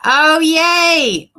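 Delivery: high, loud happy yay; excited cheer. Real, not acted. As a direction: cheer for the new member. Cheering at member signup.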